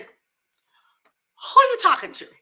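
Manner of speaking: high-pitched, drawn-out comeback; indignant challenge. Acted. Mourner challenging nosy relatives, indignant. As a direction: stretch it out high, challenging them.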